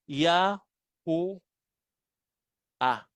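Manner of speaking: "Ya, hu, a" with pauses between the syllables; syllable-by-syllable reading. Shown as a demonstration. Teacher adds the last letter, "Ya, hu, a" as three separate syllables. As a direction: sound out "Ya, hu, a" one piece at a time. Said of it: "Iduktong mo yun dun sa dulo".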